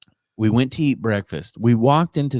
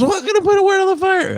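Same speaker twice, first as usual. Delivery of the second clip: much higher, goofy mocking voice; mock gawking host; 3 dB louder than usual. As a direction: ham up the dumb amazed question. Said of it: "He goes".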